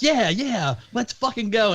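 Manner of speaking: high-pitched mock hype; rally the team, retold with relish; pumped up. Co-host quoting heroes after all the cameos, pumped up.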